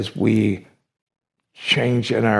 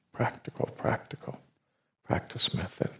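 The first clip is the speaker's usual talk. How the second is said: hushed repetition; 11 dB quieter than usual. Real, not acted. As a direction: fade into a near whisper. Repeats phrase as a hushed echo.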